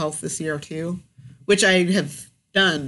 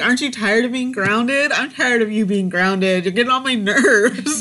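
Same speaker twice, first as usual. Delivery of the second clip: louder, higher exasperated nagging; mock-annoyed mom scolding daughter; 5 semitones higher, 4 dB louder than usual. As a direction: tell her off, fed up but joking. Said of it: "I'm like".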